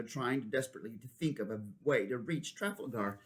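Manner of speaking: ordinary delivery, nothing marked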